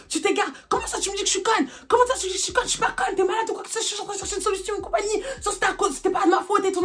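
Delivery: high, indignant replay of the phone fight; angry retort. Retold or imitated. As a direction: fire back, offended at being called stupid. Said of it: "je m'énerve au téléphone". (réplique indignée et aiguë au téléphone)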